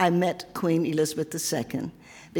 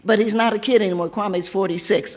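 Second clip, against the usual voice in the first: usual speaking voice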